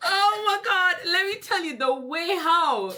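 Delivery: high, drawn-out words through laughter; helpless amusement. Real, not acted. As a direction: gasp it out, still cracking up. Recalls teasing her, helpless with laughter.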